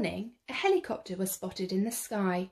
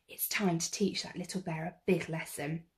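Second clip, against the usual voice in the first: ordinary delivery, nothing marked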